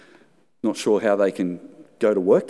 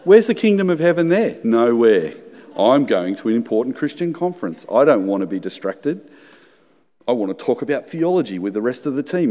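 exasperated inner monologue, louder and higher; annoyed grumble; 4 semitones higher, 3 dB louder than usual. Retold or imitated. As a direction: whine about being distracted, half-mocking yourself. Inner grumble, woman crying.

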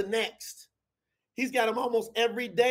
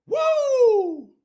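high, loud whoop; excited whoop; 14 semitones higher, 8 dB louder than usual. Real, not acted. Preacher whooping mid-sermon, loud high whoop. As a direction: let out a joyful whoop.

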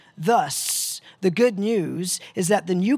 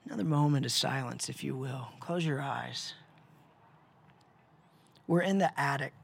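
guided meditation; 3 semitones lower than usual. Acted. Leads a guided imagery meditation.

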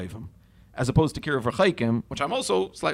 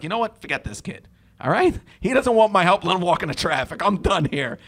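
higher, louder exasperated giving up; fed-up dismissal; 5 semitones higher, 4 dB louder than usual. Retold or imitated. Adult giving up on a screaming lost kid, fed up. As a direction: throw up your hands and walk off. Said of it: "you're not gonna be like".

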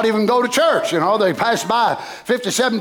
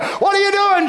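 questioning crowd; 7 semitones higher than usual. Acted. Townsman demanding of Jeremiah, high shouted question.

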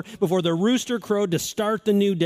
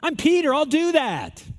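Peter's proud boast; 7 semitones higher, 3 dB louder than usual. Retold or imitated. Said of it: "Listen to the words".

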